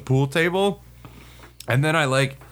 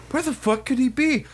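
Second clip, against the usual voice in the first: higher, baffled self-questioning; imitated talking to himself; 11 semitones higher than usual. Sergeant walking around the D fac searching, imitated baffled self-talk. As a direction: wonder aloud, pitch lifted in puzzlement. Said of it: "I literally hear him talking to himself".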